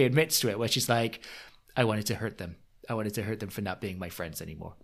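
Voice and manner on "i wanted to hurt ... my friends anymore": lightly put-on, low soft voice; low and subdued, a poor impression; terrible Vicky. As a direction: do a bad, hushed Vicky. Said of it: "That was a terrible Vicky".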